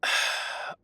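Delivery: breathy, voiceless sigh; exasperated sigh. Acted. Clerk pressed about public records, exasperated sigh. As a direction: let out a put-upon sigh.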